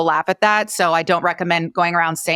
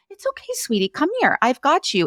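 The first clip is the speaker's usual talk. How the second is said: put-on soothing a child; 9 semitones higher than usual. Shown as a demonstration. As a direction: hold and calm a crying child. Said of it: "Or you're a little child".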